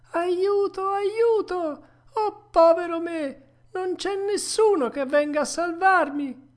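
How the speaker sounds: high, loud wail for help; tearful cry. Acted. Puppet trapped inside the sea monster calls for rescue, tearful high cry. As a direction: cry out desperately, pitched far up. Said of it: "piangendo diceva".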